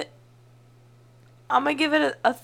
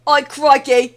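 loud, high caricature Aussie; hammed-up Australian exclamation; Australian accent; 5 semitones higher, 10 dB louder, slower than usual. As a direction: yell it like a cartoon Australian. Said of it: "when you do an Australian accent".

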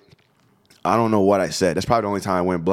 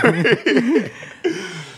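high laugh; 12 semitones higher than usual. Real, not acted. Laugh at end of story.

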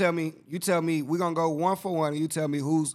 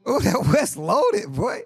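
high, slow, drawn-out ooh of amazement; awed, stretched-out exclamation; excited; 7 semitones higher, 4 dB louder, slower than usual. Real, not acted. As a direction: savour it like a stacked roster. Reacting to the West standings.

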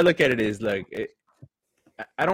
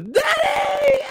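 put-on high shrieked child's cry; little daughter shrieking. Daughter laughing at dad on the floor, high shriek. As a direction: shriek like a giggling toddler. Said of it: "She goes".

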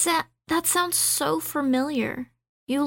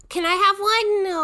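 squeaky ask; 9 semitones higher, 4 dB louder than usual. Retold or imitated. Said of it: "Pants is like".

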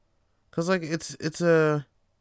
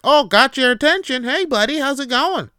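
higher, louder cheery clerk; imitating the grocery clerk chatting him up, higher and louder; chatty grocery clerk; 9 semitones higher, 5 dB louder than usual. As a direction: play the overfriendly grocery guy. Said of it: "he's like".

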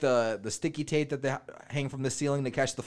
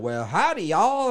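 loud, high country howdy; exaggerated country accent, voice jumping up; 7 semitones higher, 8 dB louder, slower than usual. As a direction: greet them in a hammed-up country twang. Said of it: "all of a sudden they're like".

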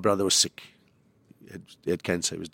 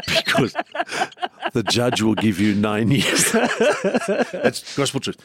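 words through real laughter, slow; laughing while talking; much slower than usual. Real, not acted. Comedian retelling his joke to his dying brother, laughing. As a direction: keep laughing through the punchline.

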